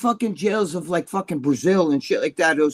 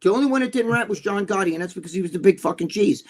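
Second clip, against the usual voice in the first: normal talking voice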